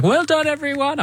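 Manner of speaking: high-pitched congratulatory call; cheery encouragement. Retold or imitated. Newcomer on the sidelines praising every child, cheery high call.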